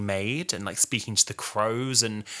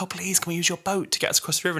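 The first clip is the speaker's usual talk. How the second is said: high, quick imitated plea; mock pleading; 9 semitones higher, faster than usual. Travellers asking a boat owner for help, mock pleading. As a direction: beg in a pitched-up, hurried mock voice. Acts travellers begging the boatman.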